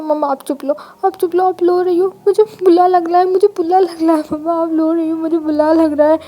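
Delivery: put-on a small child upset that mommy is crying; whiny little boy. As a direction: toddler begging his crying mom to stop. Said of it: "तब Ivan कहता".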